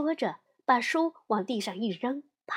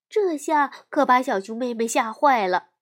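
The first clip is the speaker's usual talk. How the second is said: usual speaking voice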